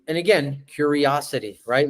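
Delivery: usual speaking voice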